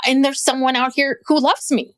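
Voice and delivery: ordinary delivery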